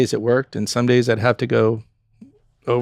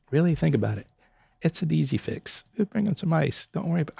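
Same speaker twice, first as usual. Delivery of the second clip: softer, higher, soothing reassurance; calming staff; 4 semitones higher, 7 dB quieter than usual. Retold or imitated. Owner reassures upset servers about hot soup, soft and calming. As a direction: settle the worried server down gently.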